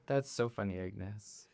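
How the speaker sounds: soft, low, fading praise for a weak joke; polite humouring. Acted. Pyra humouring her mentor's joke, soft and polite. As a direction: humour him quietly, trailing away. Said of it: "I give him a sympathy laugh".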